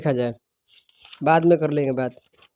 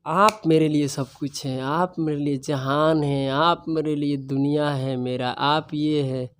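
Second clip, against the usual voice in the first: mock sweet talk; 5 semitones lower, 7 dB quieter than usual. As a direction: ham up a lovesick boy's sweet nothings, softly. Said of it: "लड़कों का जब first दोस्ती होता है".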